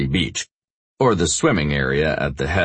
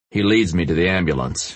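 ordinary talk

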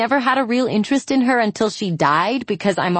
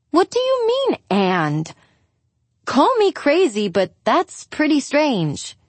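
exasperated; 8 semitones higher than usual. Acted. Julia exasperated at Angie's shrug.

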